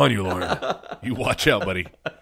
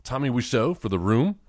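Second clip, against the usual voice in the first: usual speaking voice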